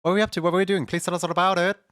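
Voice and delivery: high and loud, bubbly; excited. Real, not acted. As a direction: greet the raiders all excited. Greeting raiders with excitement.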